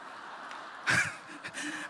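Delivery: soft airy laugh, barely voiced; breathy laugh. Real, not acted. Preacher laughing at his own story, soft airy laugh. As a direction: laugh quietly at the joke.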